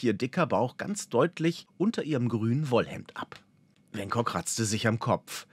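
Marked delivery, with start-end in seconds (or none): none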